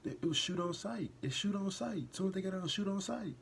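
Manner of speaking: high, loud, insistent protest; agitated outrage. Real, not acted. Agitated about double standard in policing.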